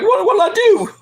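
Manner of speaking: mock bewildered Corey. Host mimics Corey confused at Carmella's anger, high and whiny. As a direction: play the clueless husband in a high voice. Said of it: "He's like".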